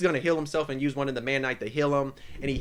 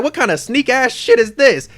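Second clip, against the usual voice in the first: higher and louder, outraged at the cheap shot; indignant outburst; 9 semitones higher, 9 dB louder than usual. Retold or imitated. Cosmo to the Demon Lord about the sneak attack, loud and indignant. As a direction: complain loudly about the dirty trick.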